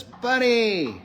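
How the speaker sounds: high excited call of a name; excited child calling. Retold or imitated. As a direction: call out happily like a little girl. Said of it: "Lisa says".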